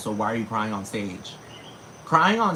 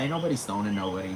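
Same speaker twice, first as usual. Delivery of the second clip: ordinary talk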